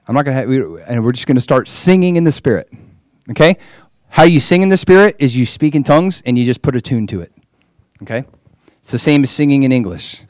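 ordinary talk

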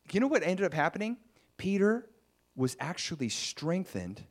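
ordinary delivery

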